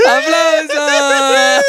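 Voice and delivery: high, long drawn-out cry; calling for applause. Real, not acted. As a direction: stretch it out high to rouse a crowd. Calls for applause after poem. (pidiendo aplausos, agudo y alargado)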